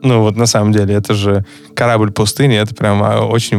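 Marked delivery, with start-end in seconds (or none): none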